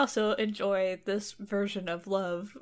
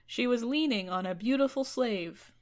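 usual speaking voice

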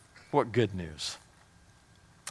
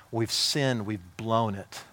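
usual speaking voice

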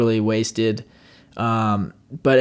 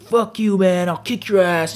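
higher-pitched mock aggression; imitating angry threat; 7 semitones higher than usual. Big dude snatching the bag, mock angry threat.

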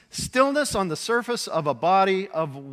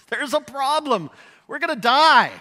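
high, urgent panic; frightened disciples crying out; panicked; 8 semitones higher, 6 dB louder than usual. Retold or imitated. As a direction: sure we're about to drown.

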